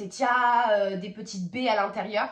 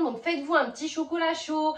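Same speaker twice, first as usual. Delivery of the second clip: ordinary talk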